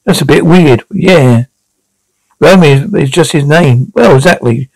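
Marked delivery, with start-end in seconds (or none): none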